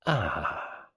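very soft satisfied "Ah"; soft murmur. Acted. Old woman savouring her son's misery, very softly. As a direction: breathe it out quietly. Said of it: "Very softly, Missus Boynton murmured".

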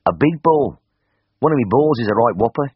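broad Birmingham patient spelling out his trouble; Birmingham accent. A narrator's reading. Patient explains his swelling to the vet, loud Birmingham voice.

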